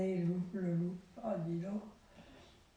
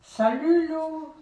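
loud, high hello from a friend; cheery friend's greeting; 8 semitones higher, 9 dB louder, slower than usual. Acted. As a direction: call out a bright hello. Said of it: "fit son ami".

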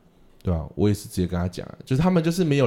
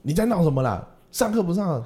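mock scolding; 8 semitones higher than usual. Imitating an angry adult scolding.

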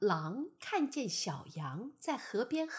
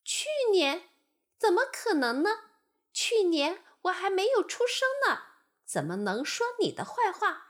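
high, louder lamb voice in disbelief; arguing lamb; 8 semitones higher, 4 dB louder, faster than usual. Acted. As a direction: argue back, amazed. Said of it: "争辩道". (小羊不可思议地争辩)

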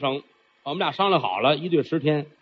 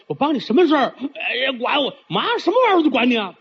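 high, raised quarrel; a high raised quarrel with the dim monster; bickering voices; 7 semitones higher, faster than usual. Acted. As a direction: play the muddled argument. Dragon mother and monster bicker.

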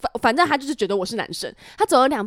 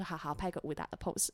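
meek quiet reply; 6 semitones lower, 15 dB quieter than usual. Retold or imitated. An actress meekly agreeing to the co-star's pose, quiet. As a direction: softly say 好，好，拍个武打的pose, almost under the breath. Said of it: "我说".

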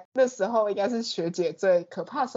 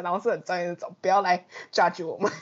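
ordinary talk

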